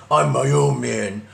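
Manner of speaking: drawn-out put-on guy voice; mock bitter misogynist. Man he avoids sounding like asserts himself, slow mock voice. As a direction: caricature a man insisting on his independence. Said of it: "come off as, like, a guy".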